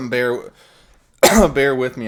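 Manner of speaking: coughing through the words, winded; out of breath. Real, not acted. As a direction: push the words out between coughs. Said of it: "sorry if it sounds like I'm out of breath".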